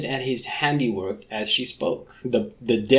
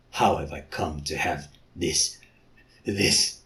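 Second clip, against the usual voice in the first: low mutter; 6 semitones lower, 4 dB quieter than usual. Acted. Leopard man voice continues.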